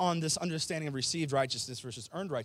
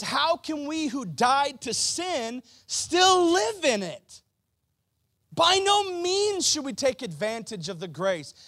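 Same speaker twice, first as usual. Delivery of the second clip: higher, louder, slow fiery preaching; full-force preaching; 10 semitones higher, 6 dB louder, slower than usual. Acted. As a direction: preach it hard to the congregation.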